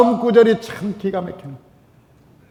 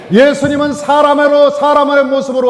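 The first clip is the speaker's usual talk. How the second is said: preaching shout; 8 semitones higher, 4 dB louder than usual. Acted. Preacher proclaims climax.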